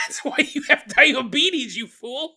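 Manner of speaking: high, loud mock yell; mock scolding. Comedian scolding the family over butter, mock yell. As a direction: scold them like fools, laughing.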